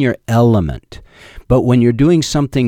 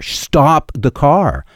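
retold shout; 6 semitones higher, much slower than usual. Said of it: "just shouted to her".

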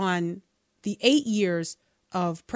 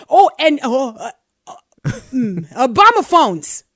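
mock flustered dodge; 3 semitones higher, 9 dB louder than usual. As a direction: ham up a cornered politician grasping for catchphrases. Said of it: "it's like".

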